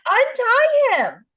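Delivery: very high, loud, slow pleading; a man on a cross begging, high, loud and slow; desperate Brian. Retold or imitated. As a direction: beg them to untie you in a high, loud voice. Brian begs to be untied.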